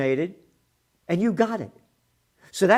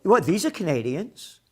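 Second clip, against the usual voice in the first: ordinary talk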